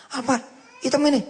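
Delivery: high, astonished disbelief; can't believe he gets to ride in this car; amazed question. Retold or imitated.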